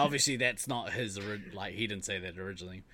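usual speaking voice

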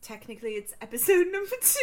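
put-on high-pitched British accent, laughing. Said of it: "We do the whole thing in a British accent".

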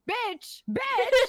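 venting, voice jumps on bitch; angry outburst. Real, not acted. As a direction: spit out the insult loud and high. Angry retelling of rude staff.

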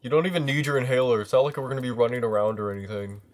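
put-on squabble like teenagers; teen bickering. Teens bickering.

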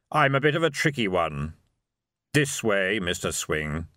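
hollow voice of Death; sepulchral, otherworldly calm; grim reaper voice. A narrator's reading. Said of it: "said Death".

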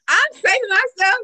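high, loud proud proclamation; proud declaration. Real, not acted. Proud declaration, saving herself.

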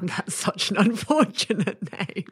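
real giggly laughter breaking into the words; laughing. Real, not acted. Cracking up at an unfortunate name, laughing. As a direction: talk through giggles, pitch jumping.

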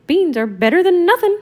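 high, loud Mickey voice; Mickey cheering up his friends; chirpy Mickey. Acted. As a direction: perk him up, squeaky and bright. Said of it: "said Mickey".

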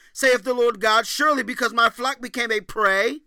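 loud, high preached scripture; scripture reading. Acted. Preacher booming scripture to the congregation. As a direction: proclaim the verses like a prophet.

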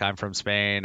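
ordinary talk

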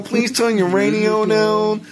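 high, loud, drawn-out radio imitation; mock radio host. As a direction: stretch it out in a put-on high voice. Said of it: "Like on the radio when they tell you".

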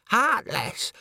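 one word of mock outrage; indignant chorister. Chorus member indignant.

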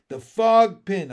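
deliberate and emphatic; said slowly. A narrator's reading. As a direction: say it slowly, with weight. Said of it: "I said slowly".

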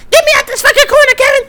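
high-pitched, rushed mock screech; acted frantic yell. Woman demands release from the corner, mock frantic yell. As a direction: yell it fast and high, hammed-up.

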